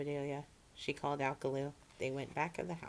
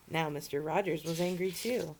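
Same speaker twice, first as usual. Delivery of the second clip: usual speaking voice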